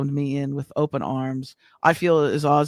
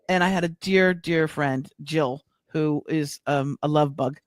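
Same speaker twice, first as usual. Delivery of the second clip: ordinary delivery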